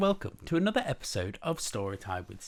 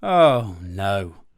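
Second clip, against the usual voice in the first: loud, groaning dismay; put-upon bear groan; exasperated bear; 3 semitones lower, 10 dB louder than usual. Acted. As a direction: groan it out loudly, sleepless. Mister Bear kept awake again.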